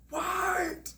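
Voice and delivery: very high mock yell; mimicked yell. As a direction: squeal it out like a yelling stranger. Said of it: "She starts, she yells".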